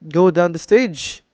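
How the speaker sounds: ordinary talk, nothing marked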